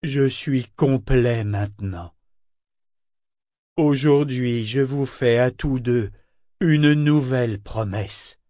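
slow, weighty declaration; solemn living ship. A narrator's reading. Parangon promising the two of them, slow and solemn. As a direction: pronounce it deliberately, as a vow.

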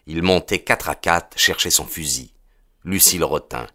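plain reading voice

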